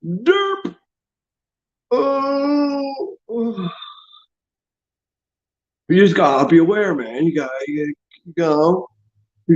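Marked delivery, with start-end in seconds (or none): 0.0-3.7 high, goofy derp voice; mock dimwit. Jackasses asked what they're doing, high dopey mockery. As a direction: imitate a clueless dope, pitched way up. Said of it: "And then they're gonna go".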